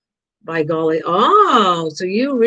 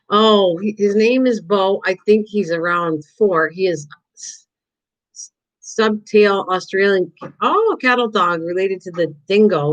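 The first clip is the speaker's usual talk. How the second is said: usual speaking voice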